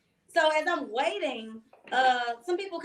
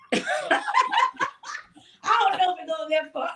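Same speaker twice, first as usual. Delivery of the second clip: squealing high then a loud laughing objection; laughing protest; 7 semitones higher, 4 dB louder than usual. Real, not acted. Guest to host about the Vegas ride, laughing loudly. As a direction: crack up while objecting.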